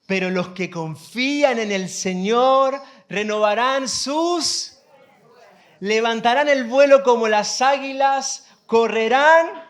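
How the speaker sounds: loud, rousing Bible verse; ringing pulpit delivery, phrase by phrase; proclaimed scripture reading. Acted. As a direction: proclaim it to the congregation, building in force. Reads scripture to congregation.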